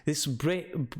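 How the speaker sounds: put-on old local man's northern English with Scots touches; rustic northern English, slipping toward Scottish; northern English dialect. Old man scoffing to visitors about warnings, northern English dialect. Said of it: "did not quite understand his dialect".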